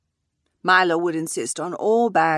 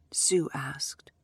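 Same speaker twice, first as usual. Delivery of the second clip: ordinary delivery, nothing marked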